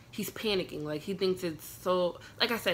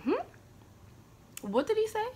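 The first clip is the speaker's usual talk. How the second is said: sudden much higher, puzzled double take; stunned disbelief. Retold or imitated. Hearing the host's strange compliment, shocked high-pitched disbelief. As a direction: react like you can't believe what you just heard.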